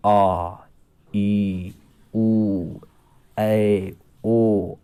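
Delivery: each vowel आ ई उ ऐ ओ held long and said out clearly; teacher calling out long vowels one by one; long vowels demonstrated. Shown as a demonstration. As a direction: stretch every vowel like आ to show its length. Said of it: "उसकी तुलना में ज़्यादा समय लगता है".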